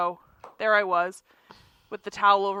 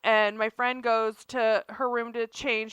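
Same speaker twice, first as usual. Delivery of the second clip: ordinary delivery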